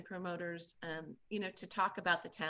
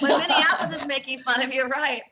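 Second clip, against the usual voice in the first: louder, higher, laughing repeat of the punchline; 7 semitones higher, 14 dB louder than usual. Real, not acted.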